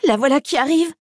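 high, urgent puppy warning; lightly acted alarm. A narrator's reading. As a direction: warn them in a small, high voice. Pup warns that villain is coming.

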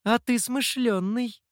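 usual speaking voice

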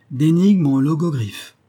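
loud, slow, bewildered outburst; a man thrown off balance, exclaiming loudly; baffled exclamation. A narrator's reading. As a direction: cry it out, completely lost. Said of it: "s'écria l'abbé tout dérouté".

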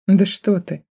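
smirking, mocking retort. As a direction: sneer it with a smirk. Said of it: "ухмыльнулась я".

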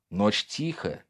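usual speaking voice

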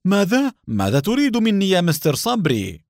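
high-pitched, startled questioning; alarmed. A narrator's reading. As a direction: blanch and ask in sudden alarm. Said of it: "شحب وجه حسين وقال". (بصوت مرتفع الطبقة مذعور)

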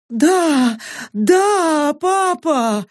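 put-on high, frightened girl's cry. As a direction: cry out in fake terror. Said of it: "Испуганно закричала Сака".

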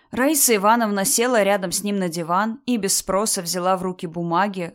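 usual speaking voice

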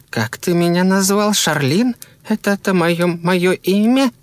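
high, puzzled questioning voice; bewildered woman. A narrator's reading. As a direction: ask in high-pitched disbelief, drawing out the end. Intruder baffled by her own name.

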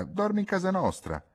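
high-pitched, all merry; cheerful exclamation. A narrator's reading. Ghisola inviting Pietro to stay, all cheerful. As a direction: pipe up gaily with a happy invitation. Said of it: "esclamò tutta allegra".

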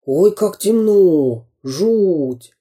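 a scared little character in the dark; frightened exclamation. A narrator's reading. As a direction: draw it out with storybook fear.

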